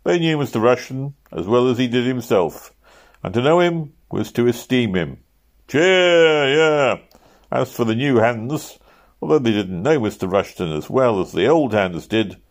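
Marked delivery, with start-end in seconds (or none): 5.7-7.0 cheer. A narrator's reading. Crowd cheering the praise.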